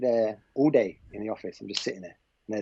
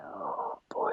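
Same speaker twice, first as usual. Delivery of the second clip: a breathless whisper of dread to himself; 7 semitones lower, 9 dB quieter than usual. Retold or imitated. Stuck on a stranger's couch, whispering oh boy in dread. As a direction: hush it like you've just realised you're stuck.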